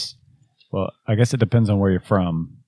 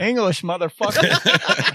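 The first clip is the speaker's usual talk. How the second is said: movie quote; 10 semitones higher, slower than usual. Retold or imitated. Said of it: "movie quote".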